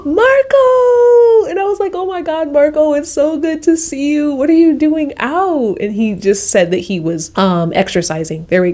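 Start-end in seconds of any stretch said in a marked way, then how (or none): 0.1-1.5 high delighted shout of his name; excited cry. Retold or imitated. Spotting their guide again outside the club, a thrilled high cry. Recognising him on street. 1.9-5.8 high, bright reunion chatter; excited greeting. Retold or imitated. Greeting her friendly guide on the street, high and excited.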